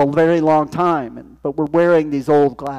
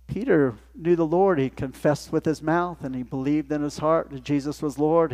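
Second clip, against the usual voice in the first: ordinary talk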